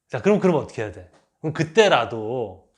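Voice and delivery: ordinary delivery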